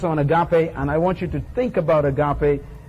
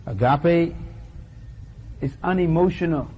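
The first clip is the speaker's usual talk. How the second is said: solemn litany; 5 dB quieter than usual. Acted. Preacher's closing litany.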